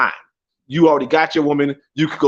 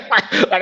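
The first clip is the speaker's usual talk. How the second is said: high laugh; 5 semitones higher than usual. Real, not acted. Laughs at own rant.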